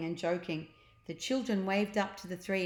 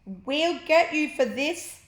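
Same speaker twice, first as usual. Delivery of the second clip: loud, high, slow furious yell; angry prisoners' threat; 8 semitones higher, 8 dB louder, slower than usual. Acted. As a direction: rattle the bars and yell revenge. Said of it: "screamed at the sky".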